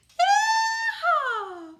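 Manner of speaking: high, loud whooping yeehaw with a laugh; big joyful cowboy whoop. A narrator's reading. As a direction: holler it like a cowboy calling the cattle in. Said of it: "cowboy call".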